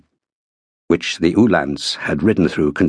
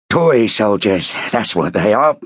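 mild gruff sergeant growl, slowed; a sergeant sneering at toy soldiers, lightly growled. Acted. Sergeant grumbling about French troops, slow mild growl. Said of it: "growled a sergeant".

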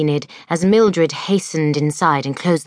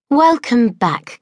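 higher, slow, beaming headmistress; Miss Cackle welcoming; 7 semitones higher, slower than usual. A narrator's reading. Miss Cackle welcomes her pupils at assembly, beaming and high. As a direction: welcome the girls like a kindly hostess. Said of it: "said Miss Cackle".

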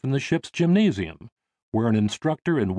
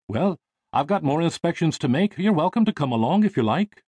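higher-pitched, brisk friendly invitation; ship designer; 7 semitones higher, 3 dB louder than usual. Acted. Designer inviting young passenger on his inspection, lightly voiced friendliness. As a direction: invite the lad along as a busy designer.

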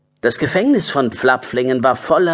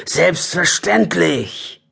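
slow, higher character voice; assuring badger voice; 4 semitones higher, much slower than usual. Harry the badger answers Meinrad's doubt, slow assuring voice. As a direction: stretch the word out with assurance. Badger reassures passer-by in story.

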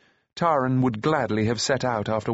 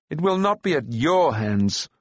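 defiant answer, slightly raised pitch; hot retort; 4 semitones higher than usual. A narrator's reading. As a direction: stand up to a bully, mildly heated. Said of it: "he answered hotly".

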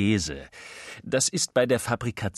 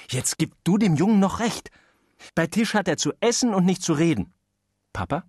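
usual speaking voice

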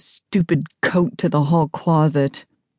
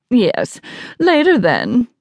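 higher, brisk self-important sign-off; haughty guest on phone; 6 semitones higher, slower than usual. Acted. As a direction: end the call self-importantly. Arrogant guest ends phone call.

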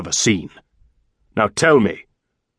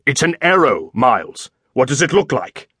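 irritated, sarcastic retort; annoyed master's comeback; spitting it out. A narrator's reading. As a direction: snap at a foolish servant. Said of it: "he spat".

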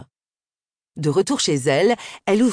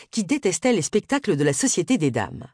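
ordinary delivery, nothing marked

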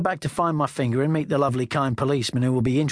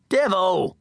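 high, urgent call of a name; shout; 6 semitones higher than usual. A narrator's reading. Calling for Devil on the towpath, high shout. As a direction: shout out for a missing mate. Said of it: "I shout".

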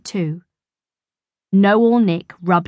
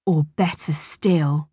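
whisper. A narrator's reading. Nick plotting secretly.

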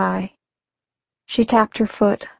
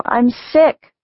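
yelled; 4 semitones higher than usual. Acted. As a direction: raise it over the radio. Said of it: "I yelled over the sound of the radio".

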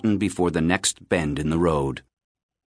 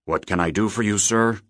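usual speaking voice

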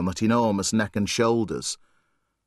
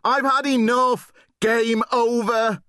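high, louder, slowing on game over; fed-up cry; 11 semitones higher, 3 dB louder than usual. Acted. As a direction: quit the game in a loud huff. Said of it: "he cried".

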